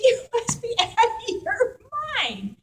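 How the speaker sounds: high, laughing through the words; chuckling you must be out of your mind; laughing disbelief. Retold or imitated. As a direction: laugh it off as absurd. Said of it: "He's cracking up over this".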